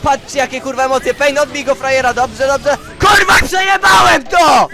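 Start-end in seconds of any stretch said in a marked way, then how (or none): 3.0-4.7 high, loud yell of defeat; furious shout. Real, not acted. Gamer realizing he lost the match, furious shout. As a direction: explode in frustration at losing.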